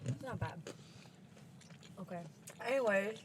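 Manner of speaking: talking through a mouthful of food; mouth full. Real, not acted. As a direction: speak with food in your mouth, chewing. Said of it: "talking with our mouth full".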